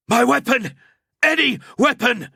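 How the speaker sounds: high, loud desperate cry; hoarse cry. A narrator's reading. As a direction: cry out for a weapon. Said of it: "cried the young man hoarsely".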